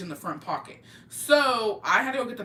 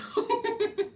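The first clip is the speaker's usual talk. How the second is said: high burst of laughter. Real, not acted. Laughs at own punchline.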